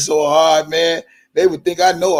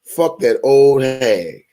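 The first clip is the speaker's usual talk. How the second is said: slow, drawn out insult; slow, spiteful repeat; 3 semitones lower, slower than usual. Acted. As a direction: savor each word slowly.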